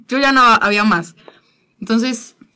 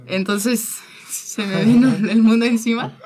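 laughing through tears. Real, not acted. Recalling the Gospel answer, moved.

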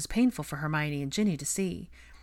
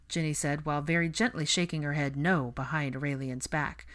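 usual speaking voice

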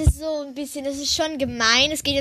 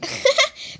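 giggle; 11 semitones higher than usual. Real, not acted. Giggle at friend running away.

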